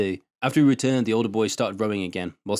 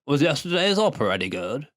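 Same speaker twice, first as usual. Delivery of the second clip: put-on old farmer voice; 5 semitones higher than usual.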